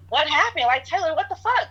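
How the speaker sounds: replaying the panic of finding the fire; stunned, climbing cry at the roommate; shocked outcry. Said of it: "and I'm just like".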